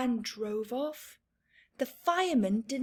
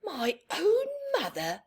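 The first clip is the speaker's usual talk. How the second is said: put-on hurt child; 7 semitones higher than usual. Michael hurt.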